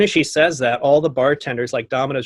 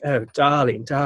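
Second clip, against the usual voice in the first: put-on British accent. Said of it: "she's from the U K".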